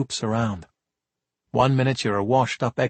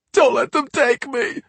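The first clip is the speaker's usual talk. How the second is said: higher, slow wailing sob; tearful plea; 12 semitones higher, 3 dB louder than usual. Acted. Drugged kid sobs to the cops, wailing. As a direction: beg through tears. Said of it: "burst into tears".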